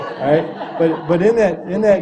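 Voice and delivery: chuckling as he restarts the sentence; laughing. Real, not acted. Amused speaker laughing about wearing jeans to the meeting, laughing. Laughing while telling joke.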